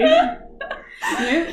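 normal talking voice

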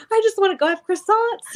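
very high, squeaky mock-tearful wail; mock weeping. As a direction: squeal it like someone pretending to cry. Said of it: "we're both weeping".

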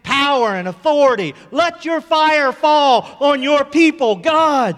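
building to a high, full-force shout; impassioned prayer cry. Real, not acted. Preacher pleading with God for his people, impassioned shouted prayer.

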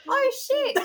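put-on high child voice copying a swear; squeaky little kid echo. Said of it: "all I could hear was Macy go".

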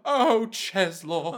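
high, drawn-out mock-tender sigh; doting. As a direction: coo the name adoringly. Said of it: "they're all like".

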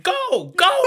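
high yelled go go; hammed-up yell; mock shouting go. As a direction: shout it like she's bossing you. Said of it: "she was just like".

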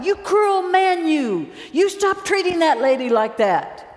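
high, loud mock holler from the back; hollering heckler. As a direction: yell at the preacher in outrage. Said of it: "some man in the back hollers out".

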